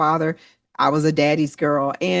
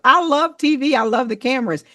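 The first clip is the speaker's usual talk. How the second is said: gushing, much higher, thrilled; excited gush; 8 semitones higher than usual. Retold or imitated. Dreamy woman recalling her love of TV, excited high gush. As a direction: voice jumps high with delight about TV. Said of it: "But I'm just like".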